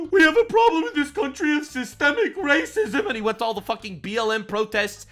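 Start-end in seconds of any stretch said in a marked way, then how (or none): 0.1-3.1 mock ranting celebrity. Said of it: "decided to come out and make these giant rants".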